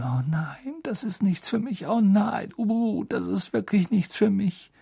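high, panicky whimpering; clinging on, terrified; frightened murmur. Acted. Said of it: "Er konnte sie murmeln hören". (ängstliches Jammern)

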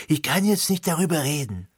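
hoch, eingeschnappt nachgeahmt; äffe ihren beleidigten Ton nach; beleidigt nachgeäfft. Retold or imitated. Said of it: "ein beleidigtes". (spöttisch nachgemachter beleidigter Ton)

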